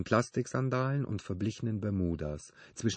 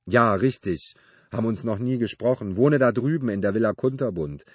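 loud, would-be jovial chattiness; grinning neighbor; 3 semitones higher, 10 dB louder than usual. A narrator's reading. Neighbor introducing himself to Benedikt, loud and jokey. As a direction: introduce yourself loudly with an attempted joke. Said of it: "Er grinste nach diesem versuchten Scherz".

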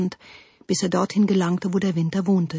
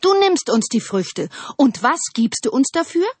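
higher-pitched accusing demand; calling out; 7 semitones higher, 4 dB louder than usual. A narrator's reading. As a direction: call him to account, rising at the end. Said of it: "riefen sie". (vorwurfsvoll rufend)

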